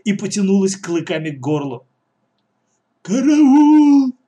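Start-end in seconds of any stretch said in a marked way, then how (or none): 3.0-4.1 loud, very high cry for help; long high squeal of alarm; squealing scream. Acted. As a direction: scream out in panic. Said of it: "заверещал Коля".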